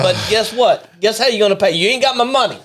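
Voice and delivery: put-on debt collector's accusation; mobster threat. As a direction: play a mock mob shakedown. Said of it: "maybe mob relations and said".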